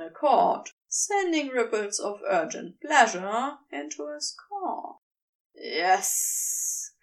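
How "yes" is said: low breathless hissed yes; delighted hiss. Acted. Serpent Crowley answering his lover, a delighted hiss. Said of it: "Crowley hisses in delight".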